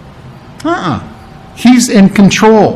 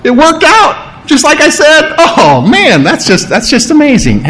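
high, gushing fake surprise; hammed-up astonishment; mock amazement; 7 semitones higher than usual. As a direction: play it as if caught off guard. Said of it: "He wasn't surprised".

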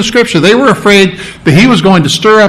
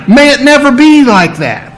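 higher-pitched mock objection; acted protest; 6 semitones higher than usual. Preacher voicing people rejecting the parable, protesting. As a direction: protest it as the appalled listeners would, voice raised in pitch.

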